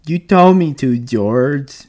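high, plaintive whimper; wretched, pleading excuse; miserable. A narrator's reading. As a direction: whine it like a child being blamed. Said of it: "he said miserably".

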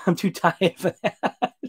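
laughing through the words; tired laugh. Real, not acted. Tired streamer turning down a viewer's request, laughing. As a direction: chuckle it out, worn out and amused.

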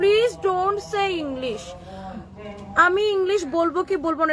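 slow, drawn-out sneering parody of a snob; mock posh. Parodying a commenter who mocks her English, slow posh sneer. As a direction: drag every word out like a stuck-up lady. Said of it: "ফালতু কতগুলা চামচা মার্কা মহিলা আছে".

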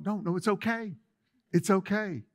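ordinary talk, nothing marked

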